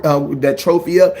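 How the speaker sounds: usual speaking voice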